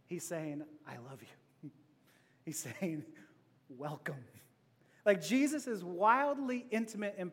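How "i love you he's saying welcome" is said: tender. Acted. Voicing Jesus's tender meaning.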